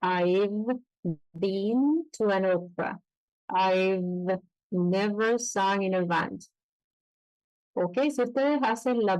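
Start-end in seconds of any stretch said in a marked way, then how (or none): 0.0-3.0 slow, buzz the V of I've before been; vibrating V in I've. Shown as a demonstration. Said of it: "diente de arriba con labio de abajo". 3.5-6.4 very slow, buzzing V in I've; teacher models the V, slowly; slow careful V. Shown as a demonstration. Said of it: "diente de arriba con labio de abajo".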